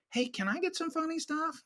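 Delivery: a hint of a star asking sheepishly for jokes; lightly voiced actor begging for funny lines; quoting the actor.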